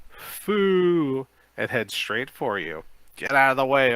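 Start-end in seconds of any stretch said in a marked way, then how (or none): 0.1-1.3 high, loud zombie moan. 3.1-3.9 loud warning shout.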